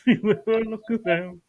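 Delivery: quick line through laughter; laughing while half-singing a love song. Real, not acted. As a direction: giggle your way through it, fast.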